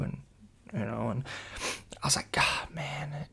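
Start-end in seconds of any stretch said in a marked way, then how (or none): 2.3-3.3 soft, whispery groaned reaction; hushed dismay. Retold or imitated. Hearing it's in his friend's shoe, hushed dismay. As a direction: breathe it out in sympathy and disgust.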